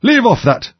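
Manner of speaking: high screamed command. A narrator's reading. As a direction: scream the order like an irate queen. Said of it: "screamed the Queen".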